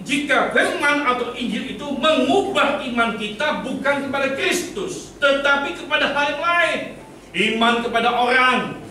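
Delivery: high, emphatic pulpit voice; ringing sermon proclamation; forceful preaching. Real, not acted. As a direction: drive each point home to the congregation. Preaches a warning.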